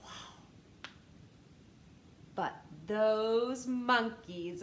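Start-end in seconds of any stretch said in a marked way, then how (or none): none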